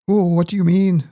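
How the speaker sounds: high-pitched, botched attempt at his accent; Jordan Peterson. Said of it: "I can't do the accent".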